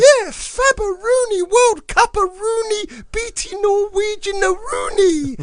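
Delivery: squeaky high puppet voice, slow and stretched; chirpy kids' TV puppet; Tweenies puppet voice. Retold or imitated. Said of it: "Bob used to do the voices of Tweenies".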